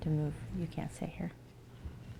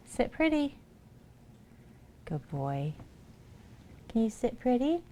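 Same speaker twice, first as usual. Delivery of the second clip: pet talk; 7 semitones higher than usual. Real, not acted. Training cats for treats.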